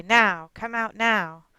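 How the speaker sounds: a firm grandma ordering, one word at a time; stern command. Retold or imitated. Grandmother orders the hiding child out, slow and firm.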